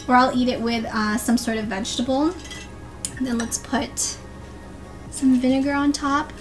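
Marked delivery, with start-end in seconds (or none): none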